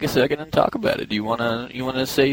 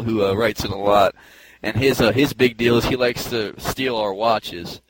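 ordinary talk

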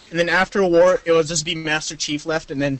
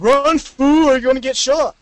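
bossy announcer; 7 semitones higher, 5 dB louder than usual. Pretend. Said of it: "When you get the flag".

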